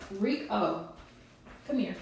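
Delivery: soft coaxing call to a pet. Real, not acted. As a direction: gently coax the bird over. Coaxing pet bird to come.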